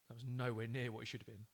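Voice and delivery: usual speaking voice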